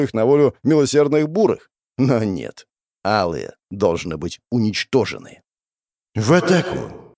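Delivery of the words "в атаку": ordinary delivery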